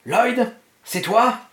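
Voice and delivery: high, slow, uncertain call; pained searching. A narrator's reading. Dying man searches for Lloyd, pained and questioning. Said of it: "cherchant douloureusement Lloyd".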